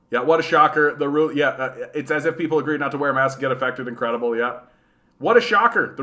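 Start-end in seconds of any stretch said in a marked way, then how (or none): 5.2-5.9 sarcastic. Real, not acted. Sarcastic reply to chat.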